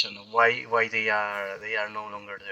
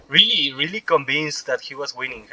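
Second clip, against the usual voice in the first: ordinary delivery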